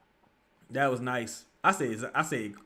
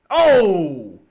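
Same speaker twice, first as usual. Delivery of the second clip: sudden oh; 3 semitones higher, 11 dB louder than usual. Real, not acted. Reacting to a play.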